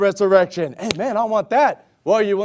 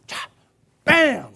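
stab sound effect; 4 semitones higher than usual. Retold or imitated. Imitated stabbing sound.

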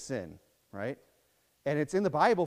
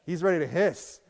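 ordinary talk

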